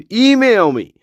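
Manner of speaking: high-pitched comic indignation; mock exasperation. Telling viewers about the email address, mock exasperated. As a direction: protest in a high, incredulous voice.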